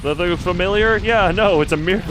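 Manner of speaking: normal talking voice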